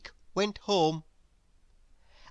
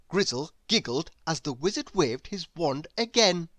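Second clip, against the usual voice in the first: plain reading voice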